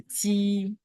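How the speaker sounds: "ti" high, long and level; sustained, unbending high "ti"; first tone. Shown as a demonstration. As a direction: hold "ti" on one high note. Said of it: "le ton".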